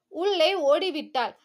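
usual speaking voice

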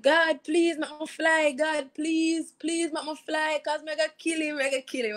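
high pleading mock cry to God; mother's desperate plea. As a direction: imitate the mother begging God to let her fly. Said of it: "she was screaming".

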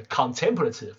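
contemplative with TEM stressed; stress on second syllable. Shown as a demonstration. Teacher explaining the adjective's stress, second-syllable stress in contemplative. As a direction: put the weight on TEM in contemplative. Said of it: "形容词要摆在第二个音节".

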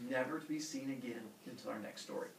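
ordinary talk

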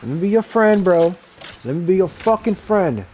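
loud, rising taunt at the enemy; taunting shout. Real, not acted. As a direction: yell it like you're hunting them down. Taunting enemy while fighting.

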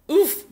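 high, loud effortful grunt; a sudden sharp "oof" of effort. A narrator's reading. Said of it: "grunted Douglas".

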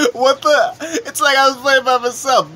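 high, loud griping; exasperated disbelief. Retold or imitated. Player griping about a goalie partner, loud exasperated disbelief. Retold exasperation at absent teammate.

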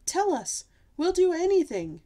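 high, loud, desperate begging; howling plea. A narrator's reading. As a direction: beg loudly as a storybook royal. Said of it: "they howled".

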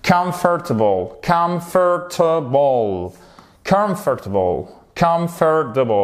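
comfortable said KUMF-tuh-bul, syllables marked; English pronunciation demo. Shown as a demonstration. Teacher modelling comfortable for French learners. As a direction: slowly split comfortable into three beats. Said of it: "la délimitation des syllabes".

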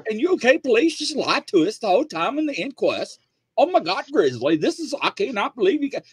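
very high, fast, mock-British amazement; excited UK listeners. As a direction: squeal it like shocked British fans. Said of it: "everybody in U K was like".

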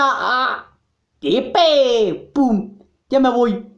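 normal talking voice

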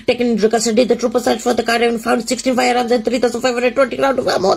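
rapid, low monotone news read; teleprompter read. Pretend. Taking a teleprompter test, reading news at a rush. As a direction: rattle off the news script low and fast. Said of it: "do the teleprompter test".

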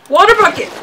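excited shout. Real, not acted. Frantic gaming call-out.

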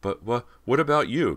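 high, loud, anxious stammer. A narrator's reading. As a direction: trip over the question in alarm. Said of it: "Cody stammered".

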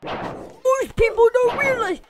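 high and loud, a silly character voice; squeaky put-on voice. Gamer dropping into a silly high voice. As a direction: pop the line out in a high squeak.

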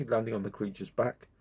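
ordinary delivery

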